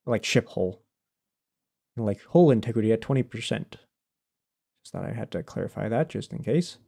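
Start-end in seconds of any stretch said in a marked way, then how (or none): none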